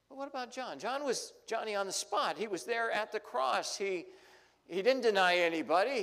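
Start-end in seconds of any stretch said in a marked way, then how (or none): 4.7-6.0 emphatic defense. Real, not acted. Preacher defending John emphatically.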